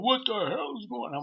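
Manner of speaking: high, loud, slow retold shout; furious driver yelling at the kids; angry driver. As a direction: bellow it at the culprit. Minivan driver demands answers.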